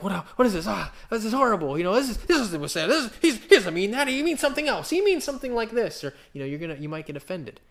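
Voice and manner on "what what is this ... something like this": higher, louder indignant protest; confused listener. Retold or imitated. Preacher voicing a puzzled reader, high and indignant. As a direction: play a puzzled reader balking at the verse. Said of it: "You can be like".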